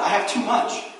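a mildly hammed-up leader pleading people to stop giving; preacher voicing an exasperated Moses; Moses fed up. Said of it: "He's like".

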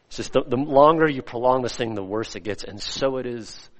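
ordinary talk, nothing marked

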